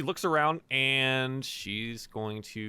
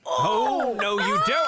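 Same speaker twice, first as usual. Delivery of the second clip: loud, high shout to stop them; angry shout; 10 semitones higher, 7 dB louder, slower than usual. Acted. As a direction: yell to stop him at the chalkboard. NPC stops the chalkboard plan.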